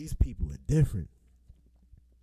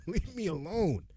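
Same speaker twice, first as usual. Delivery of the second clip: "Leave me alone" said through a laugh, half-joking; laughing plea; 5 semitones higher than usual. Pretend. Student pretending to be pestered, laughing it out. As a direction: giggle as you beg them off. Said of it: "That's how I feel".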